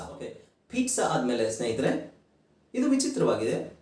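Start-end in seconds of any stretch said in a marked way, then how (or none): none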